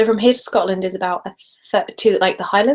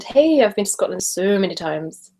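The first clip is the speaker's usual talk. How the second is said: ordinary talk, nothing marked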